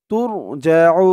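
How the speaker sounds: "তুরজাউন" with the final u held very long; three-alif elongation. Shown as a demonstration. Teacher shows the long stop, stretching u in "তুরজাউন". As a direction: draw out the u in "তুরজাউন", then close on n. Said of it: "তিন আলিফ টান হয়ে গেছে".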